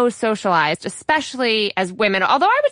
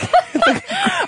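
bursting laugh that jumps up high; a real, high laugh at the joke. Real, not acted. Host laughing at guest's joke.

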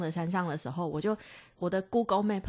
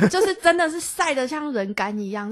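normal talking voice

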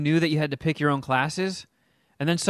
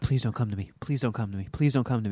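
much softer, lower pleading; quiet pleading; 7 semitones lower, 8 dB quieter, faster than usual. Retold or imitated. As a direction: beg under your breath not to be picked. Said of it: "And I'm like".

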